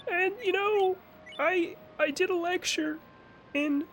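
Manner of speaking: high whiny Kermit-like voice; Jordan Peterson impression. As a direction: whine it high and slow like a frog puppet. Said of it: "I still gotta do a Jordan Peterson impression".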